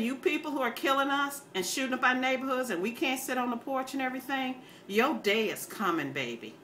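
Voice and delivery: ordinary delivery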